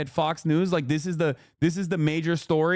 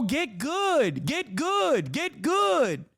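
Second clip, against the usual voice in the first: each get good pitched higher; jeering chant of get good, higher each time; taunting repeat; 6 semitones higher, much slower than usual. Real, not acted. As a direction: hammer the taunt, climbing.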